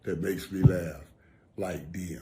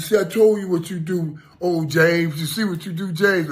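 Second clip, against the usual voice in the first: higher and louder, thrilled his voice is back; excited friend; 8 semitones higher, 6 dB louder than usual. Retold or imitated. Retelling his friend's happy words, high and loud. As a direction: imitate his buddy crowing with delight. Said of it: "He said".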